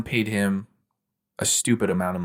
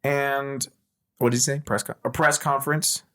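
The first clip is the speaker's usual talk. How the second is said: Robert Downey Junior impression; 6 semitones higher than usual. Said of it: "possessed by Robert Downey Junior".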